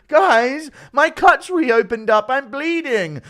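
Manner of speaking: high, whining complaint; whiny voice. Pretend. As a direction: whine like a beaten fighter. Chandler whining after being knocked down.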